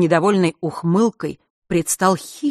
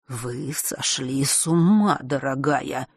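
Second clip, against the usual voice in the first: slow, low-key stern reproach; quietly severe, words drawn out; 3 semitones lower, 6 dB quieter, slower than usual. A narrator's reading. Old Fatum rebukes the woman who attacked him, sternly. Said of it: "сурово произнёс он".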